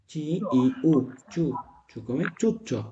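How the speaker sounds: ordinary delivery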